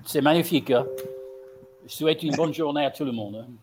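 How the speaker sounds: playful French sign-off; hammed-up French farewell; French accent. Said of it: "go out with a French accent?".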